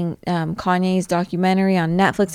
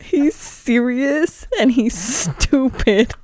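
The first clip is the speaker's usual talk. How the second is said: gleeful inner voice; 5 semitones higher than usual. Retold or imitated. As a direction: gloat like you caught him out. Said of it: "And I'm like".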